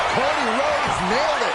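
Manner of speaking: normal talking voice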